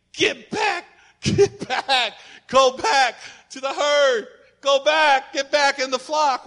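loud, high calls to go back; calling at the TV. Retold or imitated. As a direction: wave the stray back to the herd. Yelling at TV animal.

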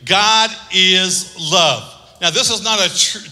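ordinary delivery

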